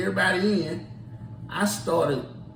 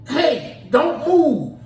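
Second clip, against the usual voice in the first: urgent call; 8 semitones higher, 7 dB louder, much slower than usual. Retold or imitated. Ordering lost visitor to stay.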